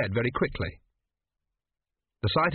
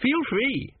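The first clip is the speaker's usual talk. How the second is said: breezy Arthur; 13 semitones higher than usual. Acted. Arthur answers sick friend.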